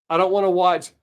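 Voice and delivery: normal talking voice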